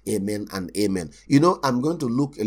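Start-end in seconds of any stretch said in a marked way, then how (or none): 0.1-1.1 soft, hushed, low prayer voice; mock soft thankful prayer; gentle quiet prayer. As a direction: pray tenderly and quietly. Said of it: "if I go to a place and say".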